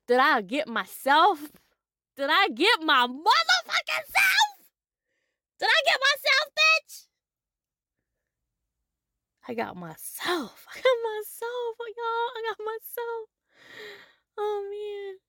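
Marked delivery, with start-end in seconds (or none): 2.2-7.0 high and loud, climbing to a yell; triumphant high shout about getting herself. Real, not acted. 10.7-15.2 pitched high with delight; glowing, giddy pride; high, elated announcement to the crowd; joyful high. Real, not acted. Joyful high after laugh.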